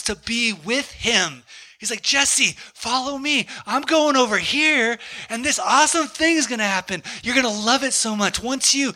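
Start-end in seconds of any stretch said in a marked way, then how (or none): 2.0-8.9 high, enthusiastic beckoning; eager invitation, imitated. Jesus calls the preacher to follow, eager invitation. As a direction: coax a friend along with mild mock excitement.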